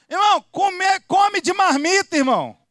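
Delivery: loud, high call to a brother; shouted message. Acted. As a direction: holler it for the camera. Calls out message for filming.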